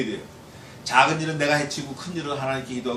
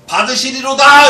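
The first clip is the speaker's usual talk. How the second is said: much higher and louder exultant cry; triumphant declaration; 8 semitones higher, 10 dB louder than usual. Acted. Psalmist announcing God accepts his prayer, exultant shout. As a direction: shout the certainty of answered prayer.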